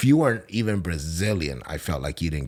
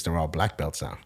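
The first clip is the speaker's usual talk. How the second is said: ordinary talk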